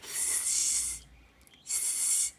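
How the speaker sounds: long, soft unvoiced sss; snake hiss. Shown as a demonstration. Teacher likens letter S to a serpent, long hiss. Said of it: "Como si fuese una serpiente".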